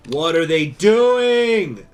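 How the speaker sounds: loud, slow drawn-out dismay; loud slow what are they doing; exasperated cry. Real, not acted. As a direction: stretch it out in loud disbelief. Game crisis, slow loud cry.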